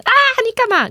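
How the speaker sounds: high, loud startled cry; startled yell. Retold or imitated. Daughter startled by her dad, high loud yelp. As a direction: yelp like a spooked girl. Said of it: "他女儿说".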